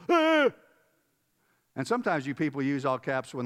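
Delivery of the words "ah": sharp, high mock yelp of alarm; startled yelp. Reader reacting to an all-caps message, mock alarmed yelp. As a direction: recoil at a text in all caps. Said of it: "Oh, I go".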